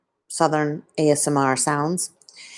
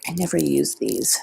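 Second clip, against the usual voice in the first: soft unvoiced whisper; 4 dB quieter than usual. Real, not acted. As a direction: breathe it out in a whisper.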